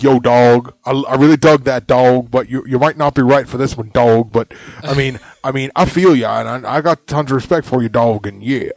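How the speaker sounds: loud laid-back judge voice; [name] impression. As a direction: hype it up like a loud bro. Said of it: "[name] over there that was like".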